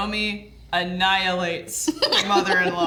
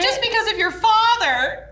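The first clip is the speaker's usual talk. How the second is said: very high, loud, hammed-up whine; mock aggrieved mother; 6 dB louder than usual. Mother blames father for ruined dinner, mock aggrieved wail.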